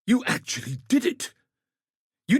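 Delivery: high, amazed cry of relief; excited praise. Acted. Commander hails the Godslayer's return, astonished and jubilant. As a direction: burst out in astonished delight.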